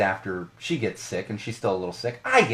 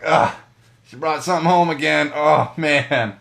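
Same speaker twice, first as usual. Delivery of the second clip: mock weary groan; 5 semitones higher, 8 dB louder than usual. Mock future self, sick again.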